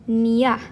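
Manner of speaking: "ñ" sounded slowly as "nya". Shown as a demonstration. Teacher demonstrating what the tilde does, slow "ñ" as nya. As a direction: stretch the n into a y-glide for "ñ". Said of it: "kind of sound to it".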